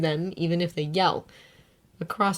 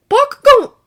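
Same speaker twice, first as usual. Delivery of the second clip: calling out; 14 dB louder than usual. A narrator's reading. Izuku calling out.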